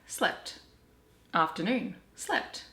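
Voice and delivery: ordinary delivery, nothing marked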